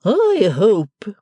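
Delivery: high, loud stately matron command; Mrs Wilfer's order. Acted. Stately mother orders the servant onward, imperious. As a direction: proclaim it like a grand lady. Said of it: "said the impressive lady".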